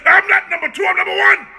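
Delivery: high shouted claim; shouted boast. Real, not acted. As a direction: bellow it as a boast.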